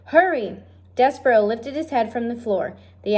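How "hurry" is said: high, impatient shout. Acted. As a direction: hurry her with a yell. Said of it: "shouted Cook".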